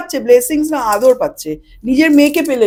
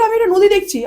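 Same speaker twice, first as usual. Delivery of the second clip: put-on 1 girl's voice; 7 semitones higher than usual. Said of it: "এটা করতে গিয়ে বলে".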